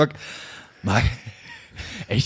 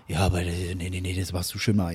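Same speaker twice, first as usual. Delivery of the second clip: lower, softer father voice; father's voice; 9 semitones lower, 8 dB quieter than usual. Retold or imitated. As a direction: play his stubborn old dad. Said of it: "Und mein Vater ist dann so einer".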